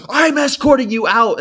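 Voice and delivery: loud, high barked command; shouted order. Retold or imitated. Store worker chasing him out, loud high shout. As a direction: bark it out like a pushy store guard.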